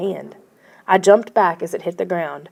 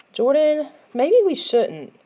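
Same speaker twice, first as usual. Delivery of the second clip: slow, hesitant plea; 8 semitones higher, slower than usual. A narrator's reading. Friend hesitating at the doorway, slow and unsure. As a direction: hold back, unsure. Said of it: "Nicole hesitated in the doorway".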